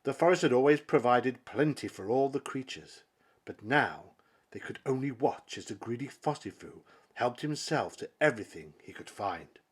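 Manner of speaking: usual speaking voice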